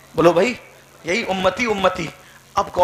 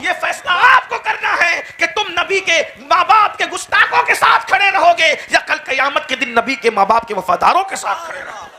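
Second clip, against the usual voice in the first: high, loud demand to the crowd; preaching challenge; 9 semitones higher, 7 dB louder than usual. Acted. Preacher challenging listeners on whose side they stand, loud. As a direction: dare them to pick a side.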